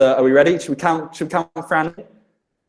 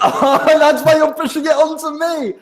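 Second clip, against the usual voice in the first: high, laughing accusation; 13 semitones higher than usual. Real, not acted. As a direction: laugh while calling them out. Caught out, laughing.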